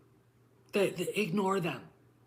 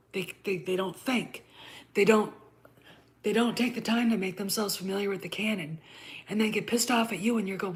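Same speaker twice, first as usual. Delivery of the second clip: ordinary delivery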